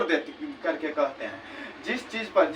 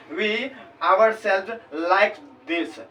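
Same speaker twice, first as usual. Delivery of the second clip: slow dictation read; much slower than usual. Shown as a demonstration. Teacher reads example sentence.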